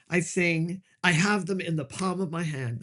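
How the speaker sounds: usual speaking voice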